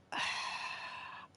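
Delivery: long voiceless exhale; real frustrated sigh; heavy sigh. Real, not acted. Player unable to get out of it, sighing. No way out of glitch.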